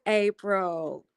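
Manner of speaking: usual speaking voice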